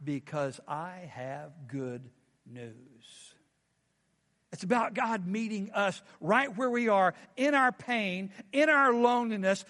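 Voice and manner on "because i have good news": very slow, much lower and softer; a mighty angel announcing, drawn out; solemn low angel proclamation; deep angel voice. Acted. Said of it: "And he says".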